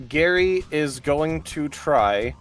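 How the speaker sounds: ordinary delivery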